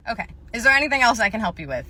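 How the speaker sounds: ordinary talk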